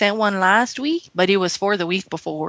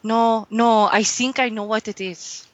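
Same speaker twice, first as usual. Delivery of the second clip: held mock Mexican accent.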